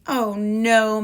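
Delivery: mild storybook yell of dismay; mock yell. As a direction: call out oh no for the kids. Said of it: "Mister Mouse yelled".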